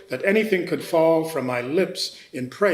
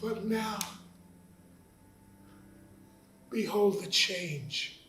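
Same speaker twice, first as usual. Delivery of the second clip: hushed wonder; 6 semitones higher, 8 dB quieter, slower than usual. Acted. Turning to hope after outburst.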